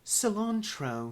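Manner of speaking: slow, put-on British pronunciation; British accent. Shown as a demonstration. Trying a British accent on request for the co-host, hammed-up British. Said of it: "say cilantro in a British accent".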